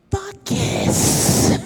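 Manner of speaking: mock the quote in hushed delight; retold fuck yes, whispered; whispered glee. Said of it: "This is what she said".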